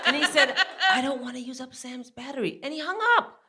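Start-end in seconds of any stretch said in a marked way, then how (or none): 1.0-2.6 softer, higher son's voice; imitated teenage son. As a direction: lightly voice the son, softly.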